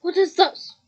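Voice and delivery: loud, angry demand; growled. Acted. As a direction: bark it at the boy. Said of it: "growled Uncle Vernon".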